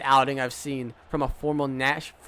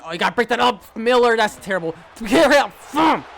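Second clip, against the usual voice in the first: high, loud shouts at the defender; frustrated shouting; 7 semitones higher, 7 dB louder than usual. Real, not acted. Gamer shouting at defender Miller, high and loud. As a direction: yell at the blown coverage. Defender gets beaten deep.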